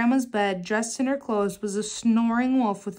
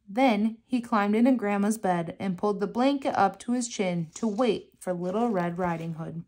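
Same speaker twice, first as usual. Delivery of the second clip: ordinary delivery, nothing marked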